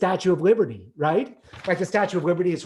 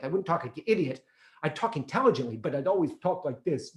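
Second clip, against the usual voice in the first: usual speaking voice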